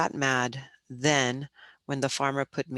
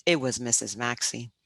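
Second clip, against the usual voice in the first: ordinary delivery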